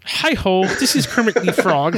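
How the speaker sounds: high, bright frog puppet voice; chirpy little puppet frog; Kermit the Frog. Pretend. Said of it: "can you do it again in the Kermit the Frog voice?".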